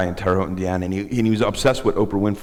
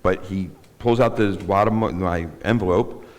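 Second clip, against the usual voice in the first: ordinary delivery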